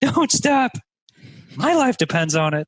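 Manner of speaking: high-pitched imitated begging; mock desperate plea. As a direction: plead as if bawling, played for laughs. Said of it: "just bawling his eyes out".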